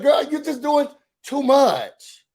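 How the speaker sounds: exasperated. Real, not acted. Telling her she's doing too much, exasperated. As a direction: stretch out "too much", fed up.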